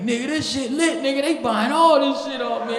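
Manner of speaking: high, loud excited voice; bragging about the hustle; hyped friend. Retold or imitated. Dion tells the comic the party buyers love him, excited.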